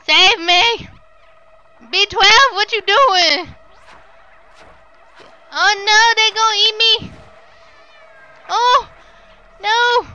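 high, loud real panic cries; panicked screaming. Real, not acted. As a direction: scream for help as they close in. Chased by monsters in game.

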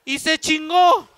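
loud, slow punchline; a loud, slow punchline for the crowd. Real, not acted. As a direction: slam the last word down.

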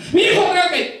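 high-pitched mock wife giving orders; bossy wife.

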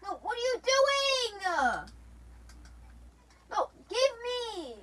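long, drawn-out high yell; screamed protest. Real, not acted. Demanding the item from a teammate, screamed protest. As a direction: wail it loudly, stretching the words.

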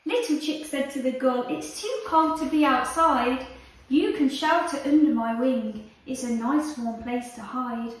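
lively read-aloud storytelling voice; reading to children. Acted. Teacher reading a picture book to her class, lively and animated. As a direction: tell it like a bedtime story for little ones.

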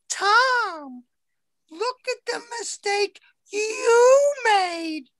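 high, slow whine of a nagging boss; whiny scolding boss. Retold or imitated. Boss whining at Tom over his mistake, high and slow. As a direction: drag out the blame like a shrill complainer.

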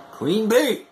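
ordinary talk, nothing marked

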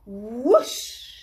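loud whoosh. A narrator's reading. Narrator blasting off the rocket with a loud whoosh.

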